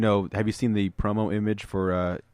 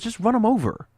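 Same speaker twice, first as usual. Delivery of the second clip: much higher, put-on voice of a cocky cop; dismissive cop; 14 semitones higher, 3 dB louder than usual. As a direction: toss it off like it's nothing, in a high mock voice. Cop voiced in comic retelling.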